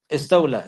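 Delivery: استولى ending in "la"; "istawla" for استولى, extra l clear; added lam. Shown as a demonstration. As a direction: repeat استولى with the l sounded. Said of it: "they will add a letter".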